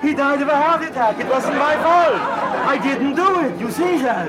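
high, frantic panic; panicked. Acted. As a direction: blurt it out in a panic. Panicking after the king collapses.

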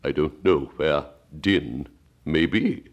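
slow, melancholy turtle voice; Mock Turtle voice. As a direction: drag it out like a sad old turtle.

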